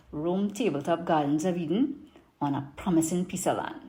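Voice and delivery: put-on lively Trini market vendor accent; Trinidadian accent. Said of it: "I'm gonna do it in my best Trini market accent".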